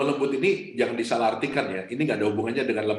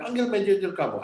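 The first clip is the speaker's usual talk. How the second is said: higher, mock angry demand for the manager; retold yell; 7 semitones higher than usual. Pastor imitates the seated customer still yelling, higher mock shout. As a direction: bark it like the fuming customer, half-hearted. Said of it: "dia masih teriak-teriak".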